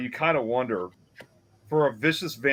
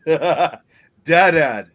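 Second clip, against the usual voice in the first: laughing; 3 semitones higher, 7 dB louder than usual. Real, not acted. Laughing wordplay.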